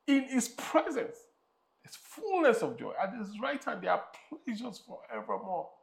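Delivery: high, fervent preaching; rising pulpit fervor. Acted. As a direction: lift the congregation. Preaching at full force.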